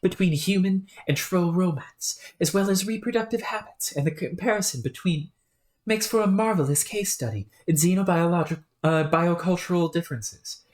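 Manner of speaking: streamer voicing a chatty British scholar; fussy, eager British accent, rattling on. Scholar offering his essays, in a British accent. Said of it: "whenever I break accent".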